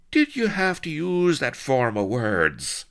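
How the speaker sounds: high, loud pained complaint; wincing. A narrator's reading. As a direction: protest as if the words hurt. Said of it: "winced Blood".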